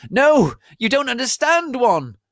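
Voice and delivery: very high, loud desperate scream; sad scream. A narrator's reading. Draco begging his friends, high desperate scream. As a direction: plead at the top of your lungs. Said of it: "screamed Draco sadly".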